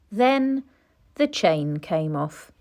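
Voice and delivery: ordinary delivery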